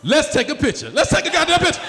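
loud and high, building to a mock shout; building demand. Comic demanding picture with pee family, building shout.

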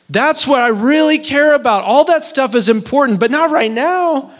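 a husband pleading with his wife in a dream, high and slow; heartbroken plea. Retold or imitated. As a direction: beg her to see what matters, pitched high. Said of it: "Lizzie, in that dream, I'm like".

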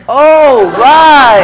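loud, high "Oh, right" as it dawns; a big retold aha; sudden realization. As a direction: act out the penny dropping, loudly. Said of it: "I'm like".

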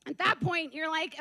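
ordinary talk

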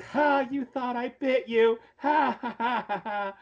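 high, slow mock gloating with spoken ha-has; sly teasing. Girl who only pretended to bite, high gleeful teasing. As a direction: crow over the prank in a high put-on voice. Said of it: "this kind of a sly grin".

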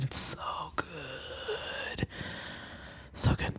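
breathy whispered praise; whispering. Real, not acted. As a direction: whisper it with relish. Savoring food memory.

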